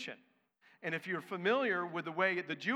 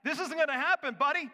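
higher and louder, insisting; emphatic; 9 semitones higher, 6 dB louder, faster than usual. Shown as a demonstration. Preacher voicing Peter's refusal, loud and emphatic. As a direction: lean hard on "gonna", flat refusal.

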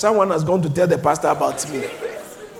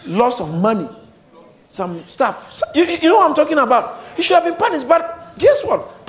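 ordinary delivery

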